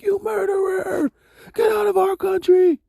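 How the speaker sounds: shrill it out like an angry crowd; mock protester yell. Preacher mimics London crowd heckling Bill Gates, high mock yell. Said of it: "They was like".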